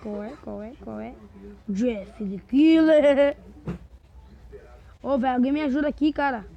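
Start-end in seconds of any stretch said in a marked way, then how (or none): 1.7-3.4 dramatic announcement. Pretend. Anuncia o vilão do jogo.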